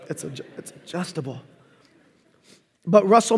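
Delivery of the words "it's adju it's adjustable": much softer throwaway repeat; quiet aside. Real, not acted. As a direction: mutter it to yourself.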